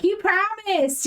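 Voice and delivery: high, indignant protest; mock accusing cry. Zabilna confronts Ilmater over a broken promise, accusing. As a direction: voice leaps up in wounded accusation.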